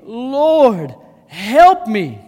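loud, drawn cry for help; pleading. A narrator's reading. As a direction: appeal forcefully, as if kneeling. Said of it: "came and knelt before him".

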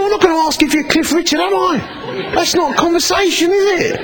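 high, fast, incredulous sing-song; exasperated retort. Retold or imitated. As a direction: toss it back as if it's obvious.

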